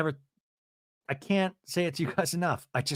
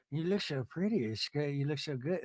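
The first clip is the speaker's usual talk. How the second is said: soft compliment; 9 dB quieter than usual. Retold or imitated. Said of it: "you have to start with".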